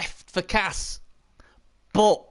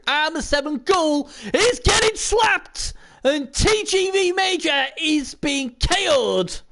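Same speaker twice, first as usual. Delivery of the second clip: higher-pitched, rising commentary call; excited play-by-play; 8 semitones higher, slower than usual. Real, not acted. Commentator calls the Ghoul getting slapped, excited. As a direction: call the knockout with real excitement. Calling a knockout live.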